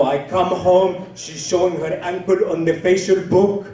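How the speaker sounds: aggrieved put-on foreign accent; Abdul. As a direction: recount the outrage in a thick foreign accent. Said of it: "his name is Abdul".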